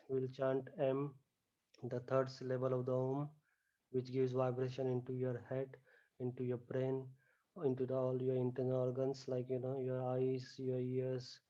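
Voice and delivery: soft, hushed meditation voice; guided relaxation. Acted. Yoga teacher guiding students lying on the floor, soft hushed voice. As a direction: ease the class down into stillness, softly.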